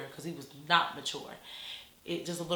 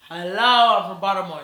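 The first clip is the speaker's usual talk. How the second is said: Baltimore talk; 9 dB louder than usual. Shown as a demonstration. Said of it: "So I talk funny".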